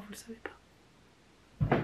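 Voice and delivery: hushed secret aside; whispering. Real, not acted. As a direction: whisper it conspiratorially.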